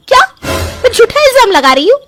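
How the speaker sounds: high, loud tearful wail, slowing down; hammed-up sobbing shout of injured innocence; fake crying outburst. Daughter-in-law fakes tears before husband against mother-in-law, loud tearful wail.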